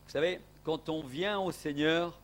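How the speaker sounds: ordinary talk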